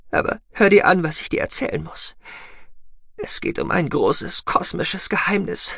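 put-on high, fragile young woman near death; urgent but failing strength; dying young woman. Voices Sahira, dying.

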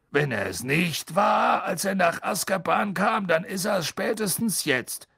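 put-on slow, gruff old driver. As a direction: take your time, deadpan. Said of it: "sagte Ern in seiner langsamen Art".

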